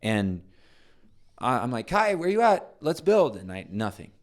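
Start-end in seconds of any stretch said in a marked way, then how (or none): 1.9-3.4 loud, high call across the house; calling a kid from another room. Retold or imitated.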